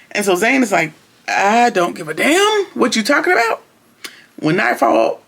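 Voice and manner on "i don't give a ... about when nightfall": high, drawn-up retort, lightly acted; mock defiance. Zane refusing Joan's plea to stay, acted-out defiance. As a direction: put on a don't-care refusal.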